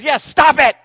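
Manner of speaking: sudden loud comic yell; mock yell. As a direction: bark the order at her, loud. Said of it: "And he yells at her".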